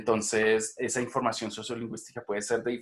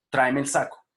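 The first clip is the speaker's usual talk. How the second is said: TRÁEME EL SACO firm and louder; request intonation; 2 semitones higher, 5 dB louder than usual. Shown as a demonstration. Teacher demonstrating how a petition sounds, request intonation. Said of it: "otro tipo de de entonación".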